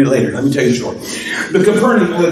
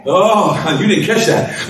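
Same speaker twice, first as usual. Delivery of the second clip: normal talking voice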